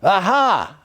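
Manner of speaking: high, loud triumphant cry; a loud, gleeful shout of vindication; exultant. A narrator's reading. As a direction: crow it like a man proven sane. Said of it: "I exclaimed exultantly".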